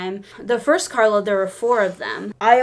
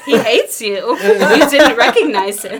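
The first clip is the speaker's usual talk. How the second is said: loud, taunting mock scolding; hammed-up loud reproach, slowing at the end; loud teasing refusal; 9 dB louder, slower than usual. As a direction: announce it loudly to embarrass a friend. Friend loudly teases embarrassed fan.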